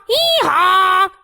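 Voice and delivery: donkey bray. Pretend. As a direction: bray hee-haw like a cartoon donkey. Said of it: "makes the sound hee-haw".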